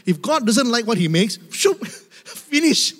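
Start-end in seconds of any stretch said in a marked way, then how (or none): 1.5-2.9 laughing. Acted. Potter discarding clay.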